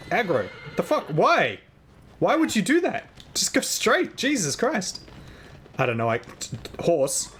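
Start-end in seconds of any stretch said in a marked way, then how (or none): 0.1-5.0 exasperated yelling. Real, not acted. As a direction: shout in disbelief at a friend. Yelling at wandering teammate.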